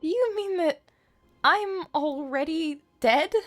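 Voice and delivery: ordinary delivery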